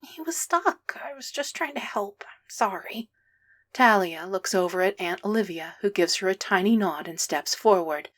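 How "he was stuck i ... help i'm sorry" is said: small, soft, high whispered apology; miserable whisper. A narrator's reading. Boy apologizing to Derek's mom, miserable soft whisper. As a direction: whisper it guiltily, close to tears.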